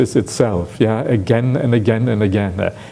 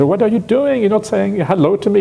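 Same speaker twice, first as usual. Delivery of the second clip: higher, a teasing note of hurt surprise; mild indignant complaint; 9 semitones higher than usual. Retold or imitated. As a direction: play the slighted guest, half-joking. Guest complains to brother-in-law.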